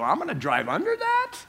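high, laughing disbelief; incredulous surprise. Retold or imitated. Driver eyeing a freight train overhead, laughing high disbelief. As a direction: squeak it out as if you can't believe it. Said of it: "I go".

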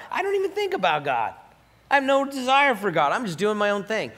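high-pitched indignant objector; mock protest. Voices an objecting listener.

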